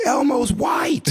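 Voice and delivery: high, drawn-out mock scream; hammed-up high scream of discovery; imitated scream. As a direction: shriek it slowly like an exposing kid. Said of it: "and screams".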